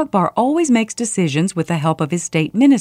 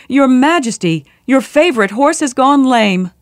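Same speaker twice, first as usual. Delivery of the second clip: high, raised call of alarm; 6 semitones higher, 4 dB louder than usual. A narrator's reading. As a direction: call bad news in from the doorway. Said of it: "called from the door".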